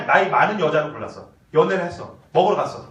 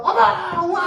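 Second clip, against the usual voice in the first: high gushing cheer for her man; excited praise; 9 semitones higher, much slower than usual. Shown as a demonstration. 설교자가 아내의 리액션을 시범: 들뜬 감탄. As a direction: gush with delight. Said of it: "뭐 사주면 막".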